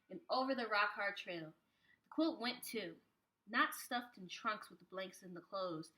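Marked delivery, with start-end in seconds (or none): none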